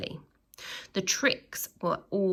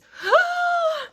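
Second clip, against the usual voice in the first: high, sharp gasp of mock shock; loud gasp; 7 dB louder than usual. Reader gasps at the spider under the bed. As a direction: gasp as if the spider bit you.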